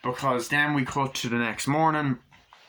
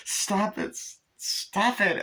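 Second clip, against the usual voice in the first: high, drawn-out objection; high-pitched acted complaint; mock protest; 10 semitones higher, much slower than usual. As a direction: imitate someone fending off a grope. Acted protest at being groped.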